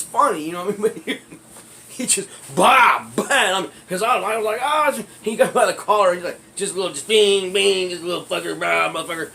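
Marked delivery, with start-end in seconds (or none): 4.6-5.0 loud high mock yelp; pained cry. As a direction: yelp like you're getting hit. Retold cry while beaten.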